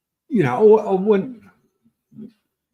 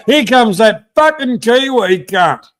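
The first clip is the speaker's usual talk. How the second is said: higher, louder rough local heckle; mock local bloke; 6 semitones higher, 5 dB louder than usual. Locals in town heckling Robert, higher and louder mock shout. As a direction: bellow it like a pub regular taking the mickey. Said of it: "They go".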